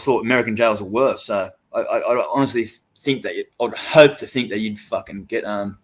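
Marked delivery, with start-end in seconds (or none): none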